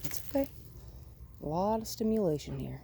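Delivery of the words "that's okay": reassuring. Real, not acted. Reassuring the nervous dog.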